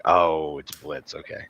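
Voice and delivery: low, trailing down very soft; acted-out hushed realization; sheepish realization. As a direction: let it sink in, dropping away quietly. Acting out realizing the mishearing.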